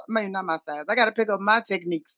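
usual speaking voice

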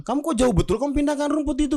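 scolding neighborhood head. Acted. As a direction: tell the boy off in a high outraged voice.

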